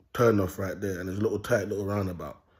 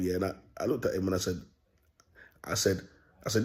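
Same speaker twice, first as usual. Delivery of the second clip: ordinary talk, nothing marked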